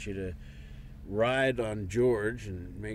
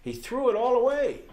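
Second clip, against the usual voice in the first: loud, high burst of exasperation; exasperated outburst; 14 semitones higher, 6 dB louder than usual. Acted. Husband to wife about their son, exasperated. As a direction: raise your voice over his wasted chance.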